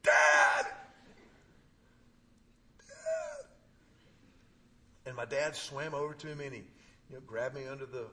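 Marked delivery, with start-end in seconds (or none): 0.0-0.7 loud panicked call for dad; a retold cry for help. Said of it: "I just went". 2.8-3.5 high echo of the call. Retold or imitated. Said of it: "I just went".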